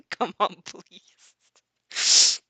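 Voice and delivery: slow, stretched-out begging through a laugh; laughing plea. Real, not acted. Heroine begging the yakuza for help, laughing and drawn out. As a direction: drag out the plea while laughing.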